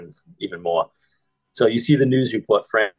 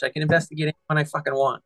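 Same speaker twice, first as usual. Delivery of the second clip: put-on whiny, higher kid voice; childish; 7 semitones higher, faster than usual. Retelling the film, mimics a childish cop. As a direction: sulk like a child. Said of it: "He sounds so childish".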